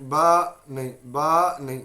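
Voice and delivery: बा ended with a loud jolt; wrong jolted ba. Shown as a demonstration. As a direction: show the abrupt, forced बा. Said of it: "ये इस तरह नहीं पढ़ना".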